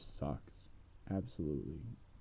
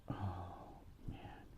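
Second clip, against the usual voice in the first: whispered dismay; 12 dB quieter than usual. Retold or imitated. Daughter mutters in despair.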